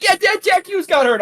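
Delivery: high, thrilled reply to dad; excited son. Retold or imitated. Charles answering his dad, high thrilled call. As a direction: call it back gleefully. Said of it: "He's like".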